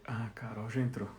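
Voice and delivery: usual speaking voice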